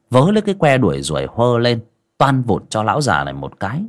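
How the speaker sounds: ordinary delivery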